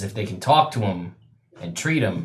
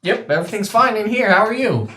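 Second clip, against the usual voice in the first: much higher, cheery mock voice; chirpy egg voice; 11 semitones higher, 6 dB louder than usual. DM voices an imagined answer from the egg in a high, chirpy mock voice. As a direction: chirp it like a creature inside the egg. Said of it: "like, a".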